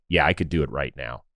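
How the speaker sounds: low, matter-of-fact, imitated self-assurance; quiet confidence. Player saying he could manage now, quietly confident. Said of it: "he had the confidence".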